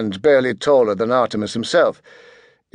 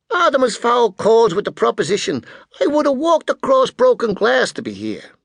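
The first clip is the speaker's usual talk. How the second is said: over-familiar American hustler, pitched way up; brash American businessman; 12 semitones higher than usual. A narrator's reading. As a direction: hail him showily in a high voice. Spyro gushes to Artemis.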